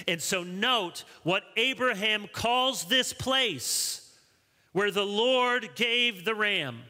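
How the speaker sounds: high, measured sermon emphasis; emphatic preaching. Real, not acted. Preaches with full force.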